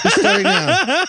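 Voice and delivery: high laughing repeat. Real, not acted. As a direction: say it through laughter. Repeating writer's line, laughing.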